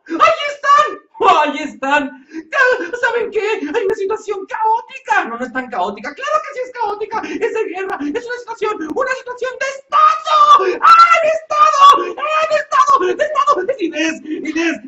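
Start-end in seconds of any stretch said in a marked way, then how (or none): 0.1-5.2 hammed-up alarm at the sight of them; panicked shout. Panicked woman spots thieves. 6.1-14.8 high, loud hammed-up hysteria; a melodramatic woman losing it completely; hysterical yelling. Inés escalates into hysteria.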